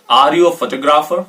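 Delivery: usual speaking voice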